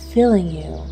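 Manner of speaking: ordinary talk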